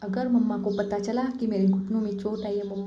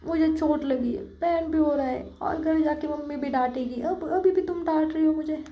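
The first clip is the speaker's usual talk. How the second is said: a touch of teary whine, pitched up; whiny crying voice; 5 semitones higher than usual. A narrator's reading. Anjali complains to Saili in a slightly teary whine. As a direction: complain like a hurt kid on the verge of tears. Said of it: "थोड़ी सी रोतू आवाज़ में बोलती".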